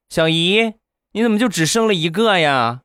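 put-on a puzzled little boy asking his aunt; small child asking. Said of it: "就问他小姨". (小孩儿天真的高声发问)

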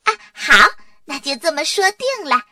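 high, perky, singsong phone voice; showy phone chat. A narrator's reading. Dora phones Emily beside Arthur to annoy him, showy. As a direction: chirp loudly into the phone for someone to overhear.

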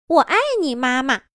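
bright, smiling “我爱你，妈妈” with a high lift on “我爱你”; sweet voice. Acted. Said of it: "用甜美的声音微笑着说道".

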